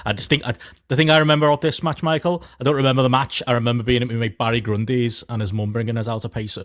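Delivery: ordinary talk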